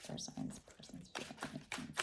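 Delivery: a soft unvoiced whisper, barely audible; whispered to herself. Real, not acted. Tarot reader whispering the question to herself. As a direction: breathe the words out to yourself.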